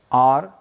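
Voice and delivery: "आर" begun on the vowel, H silent. Shown as a demonstration. Teacher shows a silent letter, "आर" starting on its vowel. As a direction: say "आर" with no H sound. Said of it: "silent के रूप में आ रहा है".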